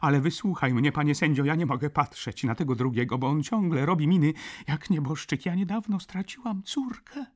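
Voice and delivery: high, agitated plea; tearful pleading. A narrator's reading. Baroness begging the judge to hear her, tearfully. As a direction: plead urgently, close to tears. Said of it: "zakończyła ze łzami".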